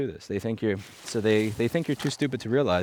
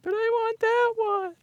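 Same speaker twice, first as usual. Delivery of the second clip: put-on very high, slow, whiny child's wail; crying little kid; slower than usual. Three-year-old wailing at his dad over a lost balloon. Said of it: "I started crying, and I went".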